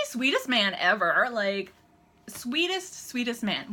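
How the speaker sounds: usual speaking voice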